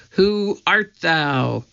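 understated angry challenge, drawn out; mildly angry. A narrator's reading. Man with pies challenging two intruders, mildly angry. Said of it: "he asked angrily".

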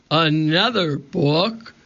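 slow, mildly roaring pirate captain; roared. A narrator's reading. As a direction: draw it out in pirate disgust. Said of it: "roared Cap'n Bones".

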